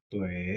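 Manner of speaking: "তোয়ে" long, low and soft, falling then rising; third tone. Shown as a demonstration. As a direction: dip low through a drawn-out "তোয়ে". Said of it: "এরকম হতো". ("তোয়ে" নিচু স্বরে টেনে, নামিয়ে তুলে)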